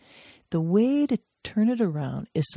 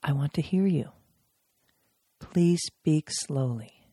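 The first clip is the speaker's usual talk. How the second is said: calm invitation. Shown as a demonstration. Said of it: "I could begin with".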